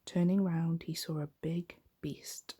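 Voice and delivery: ordinary delivery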